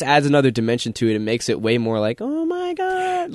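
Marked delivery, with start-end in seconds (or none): 2.2-3.3 very high, slow gushing squeal; cooing squeal. Retold or imitated. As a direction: squeal like seeing a puppy. Imitating swooning reaction to cuteness.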